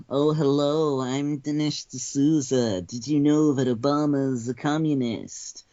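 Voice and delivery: a hint of a smug talk-show guest; Dinesh D'Souza impression. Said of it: "What do you have to say, Dinesh D'Souza?".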